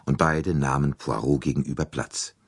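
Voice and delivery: plain reading voice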